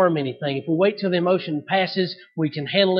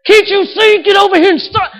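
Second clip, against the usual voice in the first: high, loud, exasperated call; a dad at the end of his rope; exasperated yell; 11 semitones higher, 8 dB louder than usual. Retold or imitated. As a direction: yell it across the parking lot at your wife. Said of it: "that's what I wanna do".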